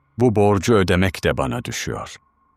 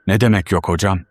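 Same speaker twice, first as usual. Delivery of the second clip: plain reading voice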